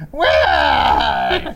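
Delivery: deep, breathy sultry call; sultry perfume ad. Retold or imitated. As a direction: call his name like a perfume ad. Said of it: "her beautiful, sultry voice saying".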